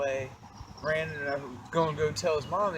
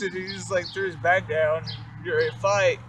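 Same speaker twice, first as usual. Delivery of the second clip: ordinary delivery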